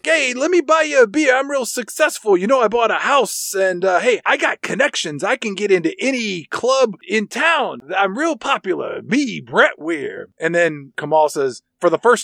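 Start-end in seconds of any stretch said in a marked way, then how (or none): 0.0-10.3 much higher, boastful show-off voice; a high-pitched wannabe bragging to two buddies; Brett Weir bragging. Retold or imitated. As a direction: puff himself up like a cheesy big shot. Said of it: "Brett Weir's like".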